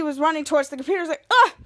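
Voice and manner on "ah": mock panic. Panic over alarm.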